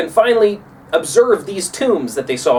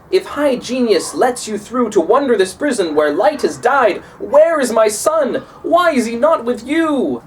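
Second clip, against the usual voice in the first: pleading, higher father's voice; anxious father; 6 semitones higher than usual. Acted. Grieving father asks Dante where his son is, pleading. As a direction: ask after the son, rising in worry. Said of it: "And he says".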